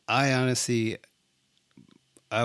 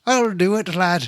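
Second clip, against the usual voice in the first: hammed-up Irish lilt, higher and louder; mock Irish accent promising to help; 8 semitones higher, 7 dB louder than usual. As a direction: play a jolly Irish lad, high and loud. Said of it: "We're sounding Irish".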